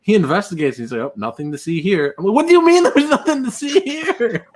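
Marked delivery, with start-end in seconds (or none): 1.0-2.2 offhand retold all-clear; casual dismissal. Retold dismissal after investigating. 2.3-4.5 high-pitched retold disbelief; incredulous outburst.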